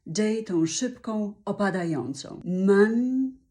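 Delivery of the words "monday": Monday louder with a strong MAN; Monday, stress on MAN. Shown as a demonstration. Teacher's final model of Monday, emphatic. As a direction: punch MAN, drop day. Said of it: "man jest tą główną sylabą".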